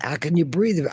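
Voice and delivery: high puzzled question. Real, not acted. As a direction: ask it, baffled. Confused by the instruction.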